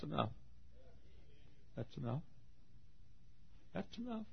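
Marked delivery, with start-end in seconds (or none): 1.8-4.2 very soft, spaced repetition; hushed assurance. Real, not acted. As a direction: let it sink in quietly. Hushed assurance after the invitation.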